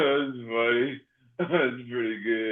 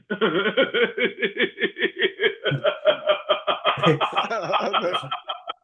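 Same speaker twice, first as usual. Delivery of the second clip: long high laugh; 6 semitones higher than usual. Real, not acted. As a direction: laugh until breathless. Laughing fit.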